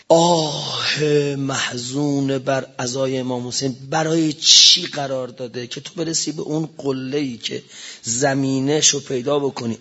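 usual speaking voice

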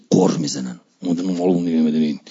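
low, fast grumble under the breath; grumbling mutter. Retold or imitated. A sulking believer grumbles under his breath, low and fast.

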